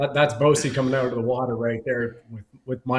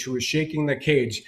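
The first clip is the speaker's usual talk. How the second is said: normal talking voice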